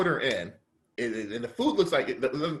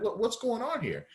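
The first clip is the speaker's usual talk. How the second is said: pitched way up in disbelief; high disbelief; 10 semitones higher than usual. Retold or imitated. Customer to restaurant staff, high disbelieving question. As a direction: ask it like you can't believe it.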